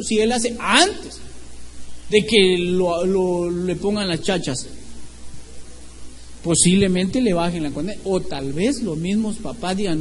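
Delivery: normal talking voice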